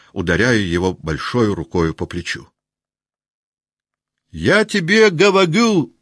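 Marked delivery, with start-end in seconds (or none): 4.3-5.9 high, loud Denisov cry; shout. Acted. Denisov shouting to the room, high and loud. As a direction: bellow your point. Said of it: "закричал Денис".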